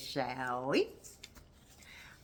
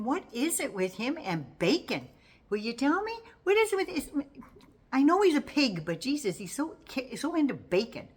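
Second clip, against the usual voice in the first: higher and louder, incredulous rant; mock-exasperated, pitch climbing, voice getting louder; exasperated outburst; 9 semitones higher, 4 dB louder than usual. Real, not acted. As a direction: throw up your hands at the bacon thing. Exasperated rant about Trump and bacon.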